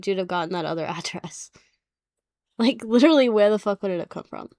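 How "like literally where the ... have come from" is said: louder, fast frustrated rant; exasperated rant. Real, not acted. Ranting about the address.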